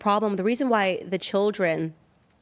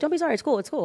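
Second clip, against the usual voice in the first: breezy reassurance; 9 semitones higher, much faster than usual. Retold or imitated. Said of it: "I'm like".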